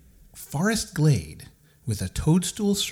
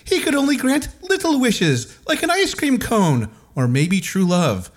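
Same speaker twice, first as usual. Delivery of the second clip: fairy queen voice; 7 semitones higher than usual. Acted. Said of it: "said Titania".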